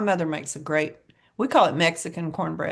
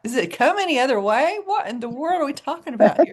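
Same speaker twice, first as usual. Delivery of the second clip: incredulous, higher retelling of own thought; mock disbelief; 7 semitones higher, 3 dB louder than usual. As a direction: act baffled that tea could be unsweetened. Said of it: "I thought".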